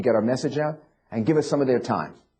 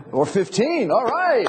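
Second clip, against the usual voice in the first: higher, rousing call to the crowd; excited rallying; 9 semitones higher, slower than usual. Real, not acted. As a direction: cheer them on with raised pitch. Candidate cheering on crowd's higher offer.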